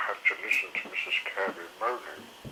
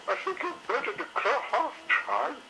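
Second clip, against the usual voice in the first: much higher, put-on matron voice; high lady dinosaur teasing; cheerful Missus Carey. A narrator's reading. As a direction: tease them kindly.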